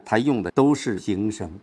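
usual speaking voice

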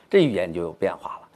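ordinary talk

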